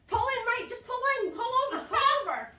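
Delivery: high, loud insistent directions; imitating someone flagging down a car; urgent calling out. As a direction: call out orders to a driver. Mechanic directing her to pull over.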